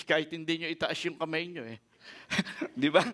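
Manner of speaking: ordinary talk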